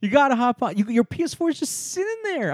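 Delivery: high, loud, insistent nagging; exasperated, high-pitched urging; playful scolding. Real, not acted. Playful scolding of absent gamer friend.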